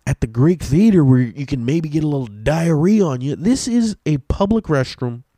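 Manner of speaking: yelling at the woman about the festival restroom; mock furious rant. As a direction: rant at her, stretching out the insults. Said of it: "I flipped the fuck out".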